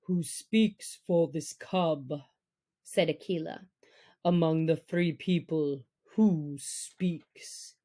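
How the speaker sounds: low, stern pack leader; measured, deep command to the wolves; Akela. Acted. Leader wolf warning the free people, low and stern.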